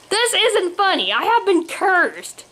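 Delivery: high, exasperated complaint; indignant protest. A narrator's reading. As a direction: protest as if nobody believes you. Swan protests at laughing son.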